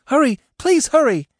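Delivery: high, loud, frantic begging; panicked urging. Acted. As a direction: beg him to hurry, terrified. Trapped woman urging rescuer.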